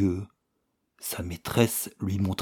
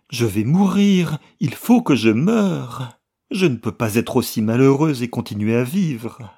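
louder, higher despairing plea; desperate letter; 3 semitones higher, 7 dB louder than usual. A narrator's reading. Daughter writing to her mother for help, desperate plea. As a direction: beg your mother as if your life depends on it. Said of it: "une lettre désespérée".

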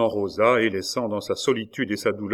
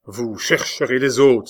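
loud, slightly raised exclamation; outraged cry; 2 semitones higher, 6 dB louder than usual. A narrator's reading. Jacob seizes the father by the collar, crying out. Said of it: "s'écria Jacob".

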